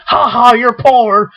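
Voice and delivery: slow, drawn-out mocking jeer; mock taunt. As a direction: jeer like a tactless bully, hammed-up. Acted taunt at a beggar.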